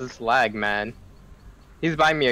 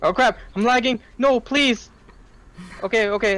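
being chased; 7 semitones higher than usual. Real, not acted. Chased by the killer, lagging.

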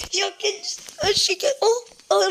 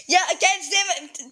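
normal talking voice

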